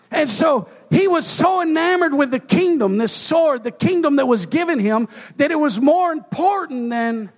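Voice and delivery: loud and high, driving the point home; fiery sermon climax; preaching at full force. Acted. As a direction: preach it hard to the congregation.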